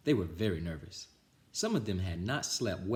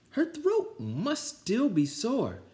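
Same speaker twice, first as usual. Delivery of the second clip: put-on high, slow, louder kid voice; high slow cover story; 4 dB louder, much slower than usual. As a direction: explain it innocently. Kids covering up.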